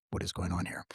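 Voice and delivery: low, soft muttered question; inner puzzlement. Retold or imitated. Himself wondering why nurses giggle, low and soft. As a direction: ask it to yourself under your breath.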